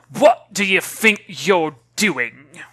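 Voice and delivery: put-on a affronted old woman glaring down; indignant demand. Woman confronts girl.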